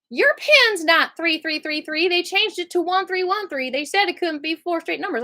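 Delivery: hammed-up correction of the wrong pin; mock officer. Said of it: "she's like".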